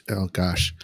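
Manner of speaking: ordinary talk, nothing marked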